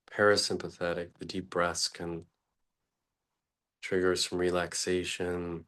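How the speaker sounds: ordinary talk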